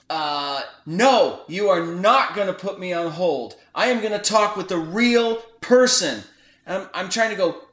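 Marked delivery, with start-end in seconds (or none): 0.1-6.2 retelling a caller cutting in loudly; the caller on the phone loudly refusing to be put on hold; loud interruption. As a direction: bark it over the other person. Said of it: "he loudly interrupts me".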